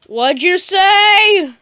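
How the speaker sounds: long, drawn-out high yell; customer's shouted question. Acted. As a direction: holler it slowly, stretching the last word. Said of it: "And you'll be like".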